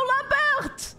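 high, hammed-up fake French accent; mock French accent, calling out high; pseudo-French. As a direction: cry mon ami like a comic Frenchman. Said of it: "Talking pseudo-French".

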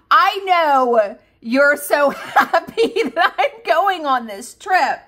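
high, loud, slow teasing quote; stretched-out mock line broken by laughs; laughing imitation. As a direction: retell his teasing through laughter. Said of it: "he had me laughing so hard".

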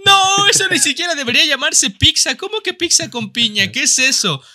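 high, indignant protest; mock outrage. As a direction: object in high-pitched disbelief. Outraged at pineapple on pizza.

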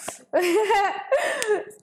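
high giggling laugh; real giggle. Real, not acted. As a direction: giggle at yourself. Laughs at herself.

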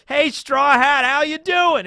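high, loud cartoonish clown greeting; Buggy voice. Retelling Buggy's greeting to Luffy.

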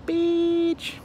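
high-pitched, drawn-out sketch delivery; Key and Peele. Acted. Quoting an old comedy skit, high and drawn out. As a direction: stretch it out on a high held note. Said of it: "that old Key and Peele skit".

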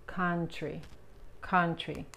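"country" with a relaxed Spanish A, "kantri"; pronunciation demo. Shown as a demonstration. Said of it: "la O U se pronuncian como la A". ("country" como "kantri", con A relajada)

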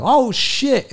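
high-pitched mock shock; hammed-up amazed reaction; imitated astonishment. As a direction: squeal it like a startled partner. Imitates her startled reaction, high.

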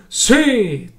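loud, high roar of assent; big high battle yell; thundering crowd shout. A narrator's reading. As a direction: thunder back as a whole mob. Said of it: "tuonò l'intera folla".

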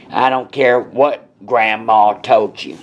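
usual speaking voice

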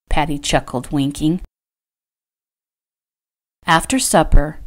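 ordinary delivery

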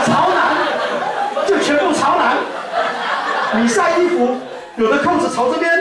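high, hammed-up nagging husband; mock fussy husband, pitched high. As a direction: scold her like a fussy soldier husband. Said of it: "老公跟他讲什么".

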